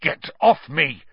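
sharp disgusted order; spat out. A narrator's reading. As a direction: spit it out in disgust. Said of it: "Harry spat".

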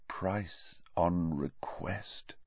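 slow, awed near-whisper; hushed echo. A narrator's reading. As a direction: echo it slowly under your breath. Same line echoed in hushed awe.

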